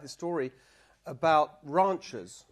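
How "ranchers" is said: "ranchers" in a British accent with a long open "rahn". Shown as a demonstration. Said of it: "ranchers is a little bit posh".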